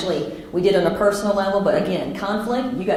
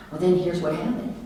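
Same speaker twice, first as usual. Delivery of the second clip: normal talking voice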